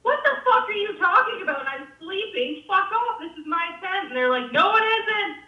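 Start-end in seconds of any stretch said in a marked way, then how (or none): 0.0-4.1 high, indignant mock yelling; indignant retort. Woken sleeper telling two intruders off, high mock outrage. Acted shouting match in tent. 4.5-5.4 high, mock-indignant denial; insistent contradiction. Two guys disputing whose tent it is, high insistent denial. Acted quarrel over the tent.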